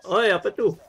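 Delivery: high, loud startled call; shouting. Real, not acted. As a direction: yell out in alarm. Calling out at something in game. (berteriak kaget)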